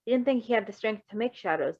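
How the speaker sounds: ordinary delivery, nothing marked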